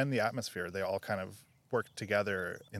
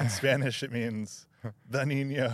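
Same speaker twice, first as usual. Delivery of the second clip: pompous comedy character voice, louder; Chris Farley El Niño; 4 semitones higher, 5 dB louder than usual. Retold or imitated. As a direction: boom it out like a self-important sketch character. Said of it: "Saturday Night Live Chris Farley character".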